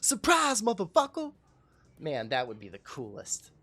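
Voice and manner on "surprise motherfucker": much higher, shouted surprise; face reveal shout. Pretend. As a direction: yell it like the big reveal. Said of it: "And I'm like".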